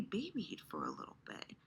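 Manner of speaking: soft unvoiced repeat; whisper. Shown as a demonstration. Host tells viewer they want babying, whispered. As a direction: whisper it gently, like a secret.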